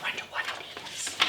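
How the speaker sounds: soft curious whisper; fast hushed aside to the kids. Acted. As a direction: lean in and whisper it. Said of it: "whispered aside to children".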